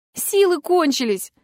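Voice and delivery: high, slow cry of despair; desperate cry. A narrator's reading. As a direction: draw it out high, at the end of your strength. Desperate answer through locked door. (отчаянный протяжный возглас)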